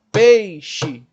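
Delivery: "Peixe" em dois tempos, PEI mais alto em volume; separando sílabas. Shown as a demonstration. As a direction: solte PEI forte, depois xe. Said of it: "quantos momentos sonoros aí nessa palavra?". ("Peixe" silabado, pei-xe)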